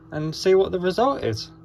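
ordinary talk, nothing marked

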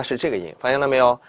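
ordinary talk

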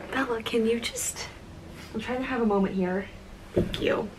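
soft, pleading aside; hushed aside. Real, not acted. Vlogger breaks off to tell Bella off, hushed aside. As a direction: quietly ask for a moment.

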